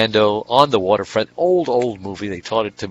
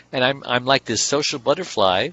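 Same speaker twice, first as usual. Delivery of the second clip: ordinary delivery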